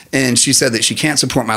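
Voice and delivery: ordinary delivery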